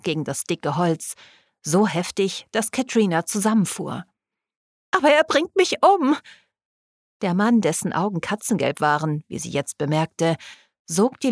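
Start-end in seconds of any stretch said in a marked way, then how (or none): 4.9-6.2 high, louder cry of fear; desperate protest. A narrator's reading. Katrina pleads with the man who refuses help, desperate. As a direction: plead in alarm, pitched right up.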